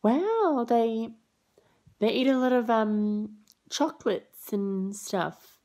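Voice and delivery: put-on telling the parents their kids eat a lot; mock polite surprise. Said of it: "I'm like".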